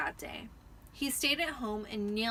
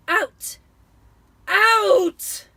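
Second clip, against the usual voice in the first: much louder, higher bellow; furious shout; 10 semitones higher, 17 dB louder than usual. A narrator's reading. Vernon bellowing at Harry amid flying letters. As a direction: roar him out of the room.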